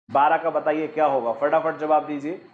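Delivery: usual speaking voice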